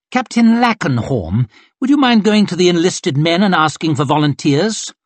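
breezy command from a vain colonel; arrogant colonel. Acted. Colonel dismissing his young corporal, lightly haughty. Glocta orders captain.

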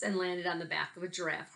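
lively picture-book read-aloud with big swings; reading to children. Acted. Teacher reading a picture book to her class, lively. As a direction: tell it like a story hour for kids.

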